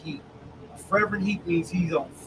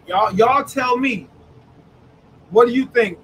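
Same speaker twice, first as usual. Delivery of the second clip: louder, calling the room to answer; 5 semitones higher, 6 dB louder than usual. Real, not acted. Pastor loudly calling on the congregation to answer. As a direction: rouse the congregation.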